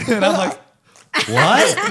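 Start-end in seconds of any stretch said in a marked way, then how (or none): 1.3-1.8 loud, rising, startled reaction; mock shock. Customer called ahead of everyone, mock shock. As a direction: retell it as a startled outburst.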